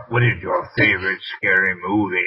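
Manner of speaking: Scream voice. Shown as a demonstration. Host asking on request, mock Scream killer voice. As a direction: put on the movie killer's phone voice. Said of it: "try and use a Scream voice".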